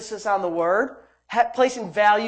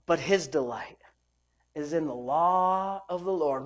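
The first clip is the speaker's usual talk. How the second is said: low, soft, slow verse; hushed, unhurried Bible reading; subdued scripture reading; 3 semitones lower, 5 dB quieter, slower than usual. Real, not acted. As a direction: read it quietly and deliberately.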